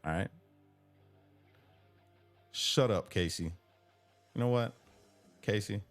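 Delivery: usual speaking voice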